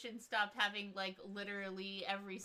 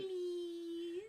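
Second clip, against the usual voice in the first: pleading whine; 8 semitones higher than usual. Retold or imitated. Pleading as necromancer.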